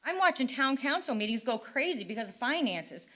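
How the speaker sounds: usual speaking voice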